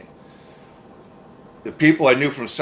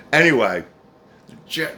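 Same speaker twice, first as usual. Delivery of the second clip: ordinary talk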